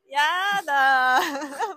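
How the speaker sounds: ゆっくり引き伸ばす嘆き; 大げさに嘆いて; 嫌そうな悲鳴. Pretend. お揃いと知って嫌がる. (お揃いを嫌がって大げさに嘆く)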